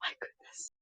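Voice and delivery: hushed, breathless my goodness; awed whisper. Real, not acted. Vlogger stunned by the angel sculpture, whispers. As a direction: whisper it under your breath in awe.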